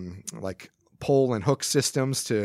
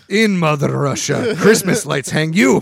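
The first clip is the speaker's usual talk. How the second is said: loud, slow, higher Russian joke voice; mock Russian accent; 5 semitones higher, 8 dB louder than usual. Joking about the neighbor, in a hammed-up Russian accent. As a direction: deliver it like a cartoon Russian.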